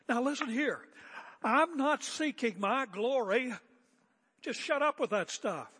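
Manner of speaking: loud, high, indignant mock shout; mock defensive outburst. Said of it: "He doesn't say".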